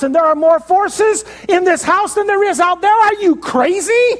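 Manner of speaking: are you crazy, voiced high; incredulous servant. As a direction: servant gaping at Elisha in disbelief. Servant disbelieving Elisha.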